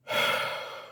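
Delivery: shaky crying exhale; a long sigh fighting tears; tearful sigh. Real, not acted. Overwhelmed by the memory, sighing through tears.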